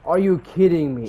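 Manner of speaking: high, loud disbelief; outraged outburst. Real, not acted. Gamer reacting to a bad break, outraged shout. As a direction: yell it in real exasperation.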